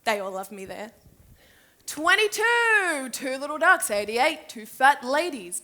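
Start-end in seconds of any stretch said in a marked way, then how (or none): 1.9-5.6 loud, drawn-out number calling; showy bingo announcer; bingo caller. Acted. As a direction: call the numbers out to the whole hall. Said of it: "to announce the bingo".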